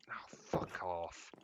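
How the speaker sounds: slow unvoiced whispered curse. Real, not acted. Cursing at the game under his breath, whispered. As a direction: hiss it under your breath, annoyed.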